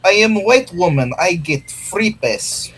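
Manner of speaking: put-on, vaguely Russian accent, slowing at the end; mock Russian-like accent. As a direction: do a hammed-up pseudo-Russian accent. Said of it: "what accent we were doing".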